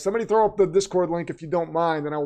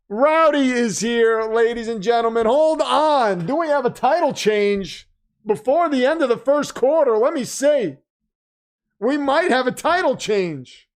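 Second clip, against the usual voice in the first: hype announcer; 7 semitones higher, 5 dB louder, slower than usual. Acted. Announces title change to stream.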